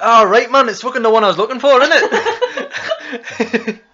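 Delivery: high, loud, fast Geordie, laughing; excited Geordie. Retold or imitated. Retelling a toy shop customer: excited laughing Geordie. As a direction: squeal it as an excited Geordie woman. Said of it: "an excited Geordie".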